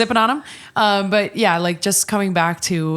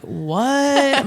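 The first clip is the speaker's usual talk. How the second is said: amazed; 7 semitones higher than usual. Retold or imitated. Amazed at before-and-after pics.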